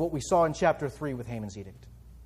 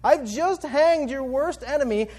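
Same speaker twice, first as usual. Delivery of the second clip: higher, exasperated king's retort; 7 semitones higher than usual. Retold or imitated. The king exasperated with Esther's request, higher pitched. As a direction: throw up your hands at the queen. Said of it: "But the king is exasperated".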